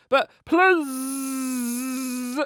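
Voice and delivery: "please" as a long buzzing "plzzzz"; buzzed please. Acted. Reading a texted plea, "please" buzzed as plzzzz. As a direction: drag out the z at the end of "please". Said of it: "it's please with P L Z Z Z Z".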